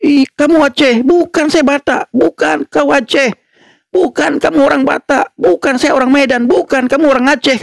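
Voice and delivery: put-on childish high squeaky argument, half laughing; mock angry squabble. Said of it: "Mereka marah".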